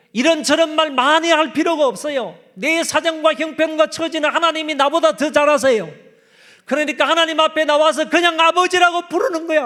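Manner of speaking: high, loud fervent preaching; loud impassioned sermon voice; preaching at full force. Real, not acted. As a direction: proclaim it with rising fervor.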